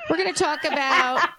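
bright, laughing lead-in; excited. Real, not acted. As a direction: gush with a laugh. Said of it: "[name], you sound so excited".

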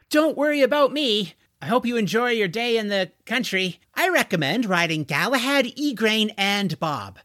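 high-pitched, eager reassurance; eager young stablehand. A narrator's reading. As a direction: send the customers off in a high, lively voice. George sees off the customers.

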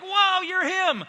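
high, slow, loud amazed whoa; awestruck Peter. Retold or imitated. Preacher voices Peter's sudden awe at Jesus, high and drawn out. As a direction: stretch it out in wide-eyed wonder.

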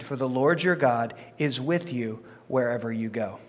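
measured, solemn Bible reading; scripture reading. Acted. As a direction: read the Lord's words with weight. Reads scripture aloud.

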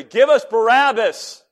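high, forceful cry of a stirred-up mob; crowd's loud demand. Retold or imitated.

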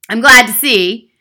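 loud, menacing headmistress; loud, overbearing bully; domineering Trunchbull. Acted. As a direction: project a threat at the whole class.

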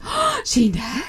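lightly voiced boy, surprised and rising; a kid hearing a crush news, mild surprise. Son learns a girl likes him, surprised. Retelling son's surprised reply.

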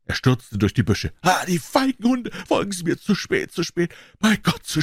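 high, anguished urgency; desperate stranger. Acted. Stranger rushes through the bushes, high despairing cry.

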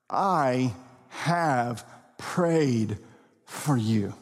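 slow, low, tender reassurance; tender comfort. Acted. Preacher voicing Jesus comforting Peter, slow and tender. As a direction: say it like a gentle promise. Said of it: "listen to this comfort".

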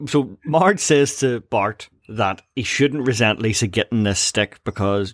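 normal talking voice

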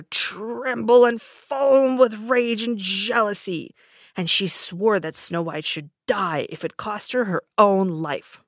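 narrated with rising fury; seething rage. A narrator's reading. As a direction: read it trembling with rage.

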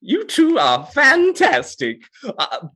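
beam with praise, then ask eagerly; delighted game master. Acted.

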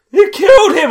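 very high, loud; high mock accusation; laughing accusation. Accusation quote.

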